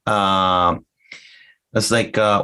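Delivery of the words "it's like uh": ordinary delivery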